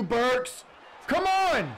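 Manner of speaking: high, loud yell climbing to the end; excited shout. Real, not acted. Gamer yelling at Burks mid-play, excited shout. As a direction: build to a scream at the receiver.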